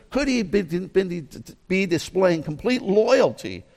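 normal talking voice

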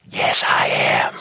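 slow, drawn-out stage whisper; secret whisper. Retold or imitated. Pastor confides to a coworker, slow whisper. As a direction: whisper it back like a guarded secret. Secret answer to leaned-over question.

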